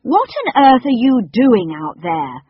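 loud, slow, high call across water; shouted. A narrator's reading. Robert shouting at the cook out on the water, loud. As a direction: shout out to her, astonished. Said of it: "Robert shouted".

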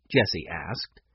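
ordinary delivery

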